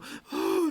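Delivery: high wailing gasp before the sob; hammed-up sobbing gasp. Widow gasps in mock grief before wailing. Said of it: "She's crying".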